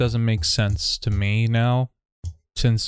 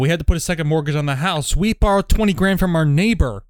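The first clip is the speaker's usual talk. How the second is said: higher and louder, lines tumbling out; rapid fire; 4 semitones higher, 3 dB louder than usual. Acted. Reading the sketch lines to his cowriter, rapid fire and raised.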